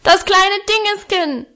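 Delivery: put-on high-pitched yell of a small boy; bellowing child. A narrator's reading. Little boy set on his feet answers Auguste, yelling. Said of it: "brüllte er".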